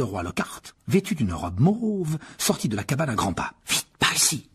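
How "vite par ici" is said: urgent whisper. A narrator's reading. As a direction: hurry a friend along in a whisper. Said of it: "chuchota Harry". (chuchotement pressant)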